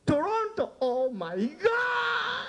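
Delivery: high, drawn-out mock gasp of amazement; mock astonishment. Comedian hams up shock at a Toronto guest, high and stretched. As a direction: stretch the oh my God way up in fake shock. Comedian reacts to audience member's city.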